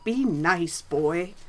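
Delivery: loud, sharp rebuke; snapping. A narrator's reading. Elf rebukes the cheeky boy, snapping. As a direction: snap it at him like an order. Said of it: "the elf snapped back at him".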